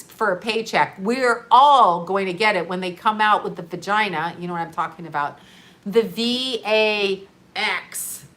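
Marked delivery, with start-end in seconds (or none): 5.8-8.3 V, A, X spelled slowly letter by letter; spelled out in code. Shown as a demonstration. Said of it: "I'm speaking in code".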